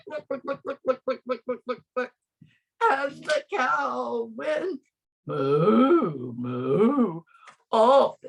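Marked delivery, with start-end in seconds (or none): 0.1-2.1 quick clucking like a hen; chicken clucking. A narrator's reading. Story's chicken noises. 5.2-7.2 low, lowing moo; cow mooing. A narrator's reading. Story's cow noises.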